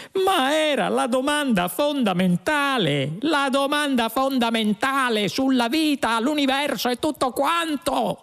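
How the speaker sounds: very high shrieked protest; exasperated yell. A narrator's reading. Lunqual protests to the computer, yelling in exasperation. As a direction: howl it in a soaring high voice. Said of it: "urlò Lunqual".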